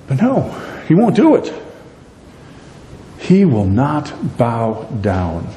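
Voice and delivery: ordinary talk, nothing marked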